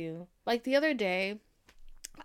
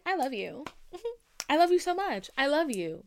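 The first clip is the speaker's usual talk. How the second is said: higher and louder, bursting with a laugh; gushing I love you; 6 semitones higher, 5 dB louder than usual. Retold or imitated. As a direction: shout your love with giggly glee. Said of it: "Now, I'm like".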